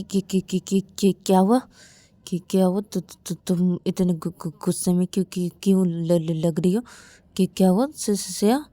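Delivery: halting, heavily repeated syllables; nervous stammering. Acted. Shruti asks angry Shreya what happened, stammering. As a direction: trip over the start of each word.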